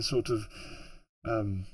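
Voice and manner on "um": usual speaking voice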